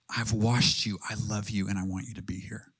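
soft, low reassurance fading to a whisper; tender, hushed. Acted. Jesus welcoming a believer into his presence, tender and hushed. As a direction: draw them close, sinking into a whisper.

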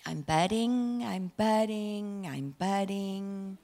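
a calm little flower humming to itself. Pretend. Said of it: "they go".

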